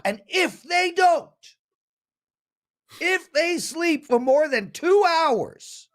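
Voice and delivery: high-pitched, incredulous ranting; sarcastic indignation. Acted. Host ridiculing the chat commenter's lazy claim, mock outrage.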